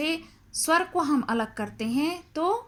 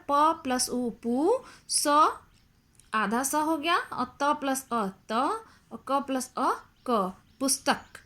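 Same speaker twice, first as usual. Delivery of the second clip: slow blending; slower than usual. Shown as a demonstration. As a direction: slowly join "त plus अ त" into "पुस्तक". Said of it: "इसको मिलाकर". (धीमे-धीमे जोड़कर "पुस्तक" बोलना)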